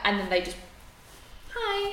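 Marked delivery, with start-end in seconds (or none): 1.5-1.9 sweet hello. Retold or imitated. Partner appears, sudden sweet hello.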